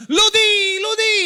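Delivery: put-on young fans cheering their idol; youth cheer. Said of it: "sa expression ng mga kabataan".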